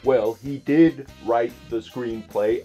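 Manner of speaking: normal talking voice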